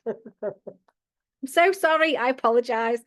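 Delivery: high, drawn-out sorry after a laughing fit; giggly apology. Real, not acted. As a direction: apologise while recovering from giggles.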